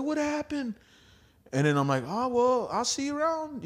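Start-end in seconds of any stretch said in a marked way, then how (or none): 0.0-0.8 way up high, asking; high surprised question. Retold or imitated. To his friend being kicked out, a high surprised question. 2.1-3.6 breezy high goodbye; high casual goodbye. Retold or imitated. To his friend outside the club, a high casual goodbye.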